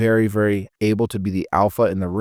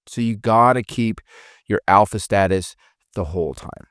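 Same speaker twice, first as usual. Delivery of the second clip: normal talking voice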